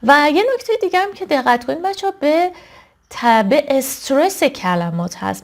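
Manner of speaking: ordinary talk, nothing marked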